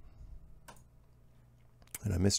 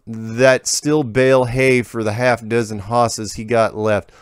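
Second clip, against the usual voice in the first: unhurried backwoods American twang; folksy rural accent; slower than usual. Retold or imitated. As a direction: read the letter like an old country farmer. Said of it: "He's not breaking character".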